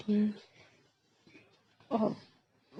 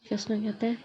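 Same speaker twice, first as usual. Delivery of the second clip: usual speaking voice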